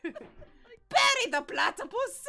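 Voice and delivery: high, slow cartoon villain cry through laughter; laughing high mock villain shout; Doofenshmirtz voice. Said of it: "You almost sound like Doofenshmirtz".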